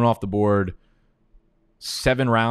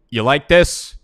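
higher, louder gloating question; loud gloating jab at his draft rival; loud taunt; 6 semitones higher, 8 dB louder, slower than usual. Real, not acted. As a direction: rub it in his face loudly. Taunting opponent after a pick.

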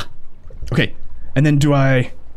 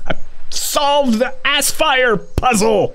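higher delighted boast; excited higher victory shout; triumphant cheer; 11 semitones higher, slower than usual. Real, not acted. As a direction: crow over solving the puzzle. Triumphant after puzzle.

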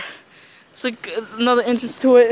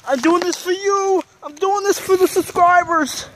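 mock brave; 7 semitones higher than usual. Brave pep talk to viewers.

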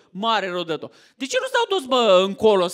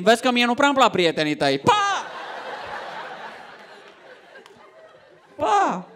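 dismissive wife. Acted. Wife dismisses husband's friends.

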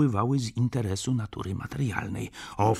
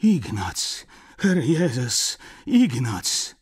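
put-on old woman's louder exclamation; crying out; 4 semitones higher, 6 dB louder, much slower than usual. A narrator's reading. Grandmother embracing the returned Ignaz, crying out. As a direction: call out the name and exclaim, each cry falling. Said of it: "zawołała, ściskając mnie".

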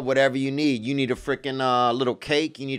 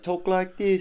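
posh English imitation; 5 semitones higher, 3 dB quieter than usual. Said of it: "if you're real fancy, you're like".

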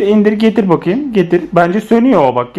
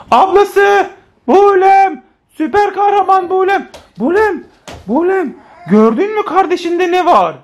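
high, playful call for a superhero; high-pitched dramatic summons; 9 semitones higher than usual. Pretend. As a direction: summon big sister with mock urgency. Calls the superhero big sister.

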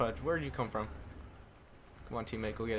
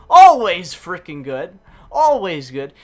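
hyped shout; 5 semitones higher, 17 dB louder, slower than usual. Real, not acted. Hyped after match win stats.

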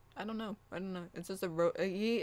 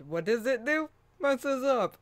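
exasperated; 7 semitones higher, 5 dB louder than usual. Real, not acted. Mocking the failed TTS.

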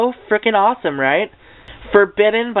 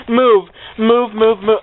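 urgent commands; 5 semitones higher, slower than usual. Real, not acted. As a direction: bark at the books to get out of the way. Shouting at falling books.

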